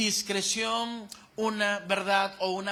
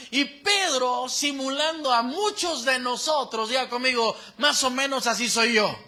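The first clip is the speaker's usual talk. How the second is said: louder, rising preacher's cadence; preaching at full force; 3 semitones higher, 5 dB louder than usual. Acted. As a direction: stir the congregation from the pulpit.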